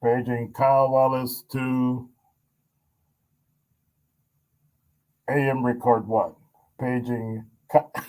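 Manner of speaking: slow, lightly voiced PA announcer; mock paging announcement. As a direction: page someone as if over the intercom. Mock page for absent colleague.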